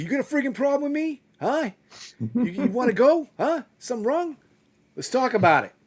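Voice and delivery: high, loud, fast tough guy challenge; tough guy accent. Retold or imitated. Dad showing his son how to scare off a bully in a tough guy voice. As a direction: square up like a street tough. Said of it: "You put on an accent".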